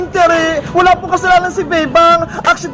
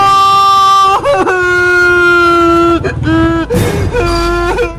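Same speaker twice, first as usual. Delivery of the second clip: crying wail. Acted. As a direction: howl in pain like a hurt child. Girl hit by scooter.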